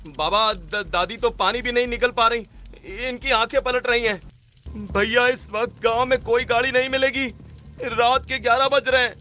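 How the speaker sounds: put-on high, urgent young-man voice; frightened grandsons. Grandsons tell father no vehicle is available, alarmed. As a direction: report the emergency in rising alarm.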